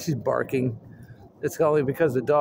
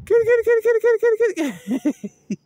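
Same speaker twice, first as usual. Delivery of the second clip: rapid-fire, squealed kitty call; high-pitched cat call; 6 dB louder than usual. Pretend. Calling kitty to come, loud and very high.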